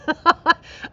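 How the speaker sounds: high, loud burst of laughter; delighted laugh. Real, not acted. Host amused by her own Nashville story, delighted laugh.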